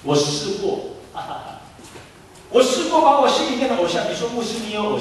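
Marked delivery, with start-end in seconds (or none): none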